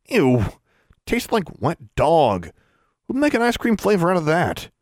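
high, grossed-out whine; disgusted. A narrator's reading. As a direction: gag at the taste, then complain. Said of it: "then gagged as he licked".

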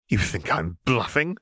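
sharp defiant snap; snapped. Acted. Seraphine snaps at laughing Renata. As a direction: bite the question off. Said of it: "she snapped".

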